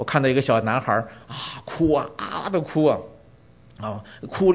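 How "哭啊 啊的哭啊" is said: ordinary delivery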